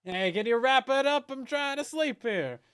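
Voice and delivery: loud, high grumpy heckler; heckling from crowd. Pretend. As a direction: shout it like an annoyed neighbour. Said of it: "Yeah, something like that".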